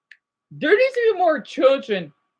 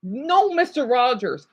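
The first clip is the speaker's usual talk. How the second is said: normal talking voice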